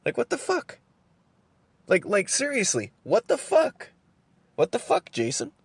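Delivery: squeaky high disbelieving what the fuck; exasperated. Real, not acted.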